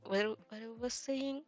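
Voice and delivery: ordinary talk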